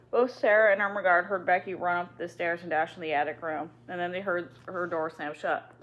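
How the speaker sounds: usual speaking voice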